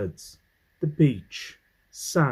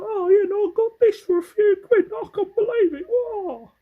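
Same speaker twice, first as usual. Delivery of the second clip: very high, loud caricature Londoner; hammed-up squeal of London market chatter; mock London accent; 9 dB louder than usual. Seller mimicking Sunday market bargain hunters, exaggerated London accent. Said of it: "That's what it sounded like".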